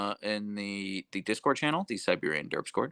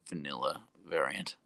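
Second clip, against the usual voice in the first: usual speaking voice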